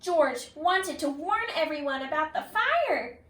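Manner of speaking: ordinary delivery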